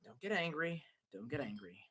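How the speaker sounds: very soft, under the breath; muttered self-calming. Acted. Streamer telling himself not to get angry, muttered. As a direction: talk yourself down, barely audible.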